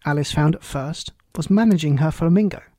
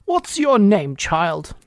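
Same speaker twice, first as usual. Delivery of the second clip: higher, louder queenly demand; imperious Queen; 4 semitones higher, 4 dB louder, much slower than usual. A narrator's reading. Queen demands an answer from Alice, imperious. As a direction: question the child like a haughty ruler.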